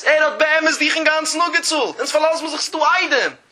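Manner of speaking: high, insistent courtroom-style objection; insistent protest. Acted. Disputant objects about relying on the witnesses, insistent.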